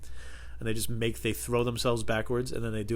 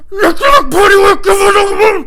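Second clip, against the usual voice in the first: high, full-force mock battle chant; screamed nursery rhyme; 19 dB louder than usual. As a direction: scream the rhyme like a war cry.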